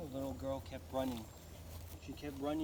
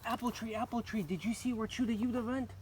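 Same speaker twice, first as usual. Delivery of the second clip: put-on urgent girl; 7 semitones higher, 3 dB louder, faster than usual. A narrator's reading. Girl asks apple tree.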